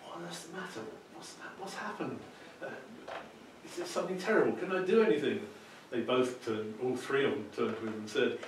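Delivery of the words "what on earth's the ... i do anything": higher, anxious calling down; young man calls down worried, higher pitched. Acted. Young man calls down to the crying family, worried.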